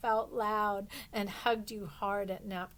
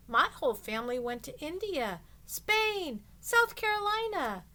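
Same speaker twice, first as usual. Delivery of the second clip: excited boasting; 6 semitones higher, 4 dB louder than usual. Acted. Classmate showing off her travels, excited and high. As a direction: pitch jumps way up on the last places.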